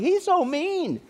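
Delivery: high-pitched imitated patient's protest; hammed-up wail of complaint; mock complaint. Retold patients complaining about the doctor.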